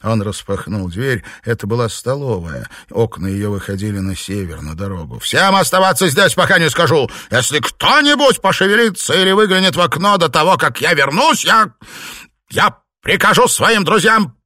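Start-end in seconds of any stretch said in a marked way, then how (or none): 5.2-14.3 loud, high raging threat; furious threat. A narrator's reading. Master threatening the servants, furious shout. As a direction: threaten them, stumbling with fury.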